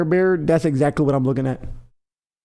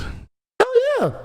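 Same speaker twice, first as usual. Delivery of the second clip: excited boast. Real, not acted. Bragging he can fight bear.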